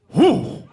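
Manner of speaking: high, loud whew of feigned bafflement; comic blown-out breath; loud stunned exhale. Retold or imitated. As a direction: blow out a big dumbfounded whew.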